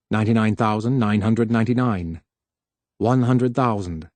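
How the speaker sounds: usual speaking voice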